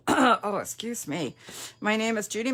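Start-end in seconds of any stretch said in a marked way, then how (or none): none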